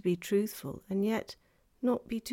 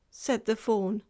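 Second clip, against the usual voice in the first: ordinary delivery, nothing marked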